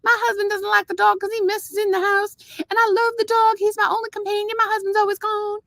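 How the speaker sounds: very high, cutesy put-on accent; hammed-up cute little accent, held high all through; Melissa Gilbert. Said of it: "she has this cute little accent".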